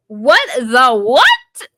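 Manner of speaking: loud, high, drawn-out shock; outraged disbelief. Acted. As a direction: stretch each word in exaggerated disbelief. Host's reaction to shocking line.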